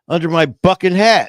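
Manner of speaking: put-on loud, high kid's retort; joke punchline. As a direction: belt out the punchline as the kid.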